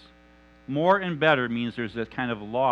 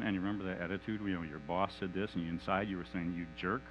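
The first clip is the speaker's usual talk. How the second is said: much softer, lower, insinuating murmur in the ear; whispering accuser; 8 semitones lower, 11 dB quieter than usual. Acted. Satan whispering accusations into Mike's ear, soft and lower. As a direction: hiss the guilt quietly into his ear.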